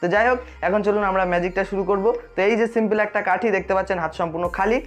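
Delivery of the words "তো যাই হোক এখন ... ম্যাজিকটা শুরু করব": usual speaking voice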